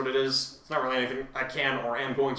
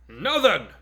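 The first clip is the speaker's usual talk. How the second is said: loud, higher booming call; booming announcement; 6 semitones higher, 6 dB louder than usual. Acted. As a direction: boom it out to the whole class. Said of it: "All Might boomed".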